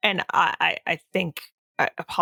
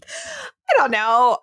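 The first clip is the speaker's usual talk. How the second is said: laughing; 4 dB louder, much slower than usual. Real, not acted. Embarrassed laughing refusal.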